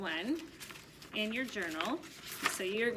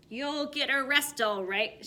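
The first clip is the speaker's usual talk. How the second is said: shouted; 5 semitones higher, 5 dB louder, slower than usual. A narrator's reading. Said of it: "shouted Mister Dart".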